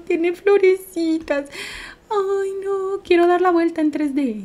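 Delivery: high, cutesy coo; cooing delight. Real, not acted. Streamer admiring a flowered outfit, high cooing delight.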